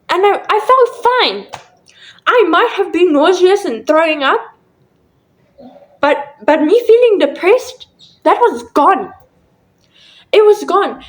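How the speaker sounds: high, emphatic disbelief; real amazement. Real, not acted. Telling viewers the depression was suddenly gone, amazed. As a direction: testify to it in astonishment, pitch lifted high.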